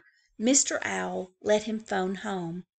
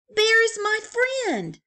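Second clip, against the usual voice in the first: exclaiming; 14 semitones higher, 8 dB louder than usual. Acted. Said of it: "exclaimed Franklin".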